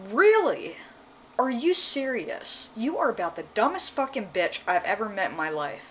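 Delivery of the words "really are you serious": high, loud disbelief; a stunned, furious "are you serious"; incredulous outburst. Real, not acted. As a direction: shoot it up in outraged disbelief. Reacting to therapist's claim.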